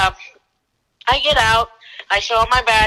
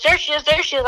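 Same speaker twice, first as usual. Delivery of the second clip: imitating his partner's higher, eager alert; mildly acted excitement, raised in pitch; excited alert; 7 semitones higher, faster than usual. Partner spotting her again at the motel, excited.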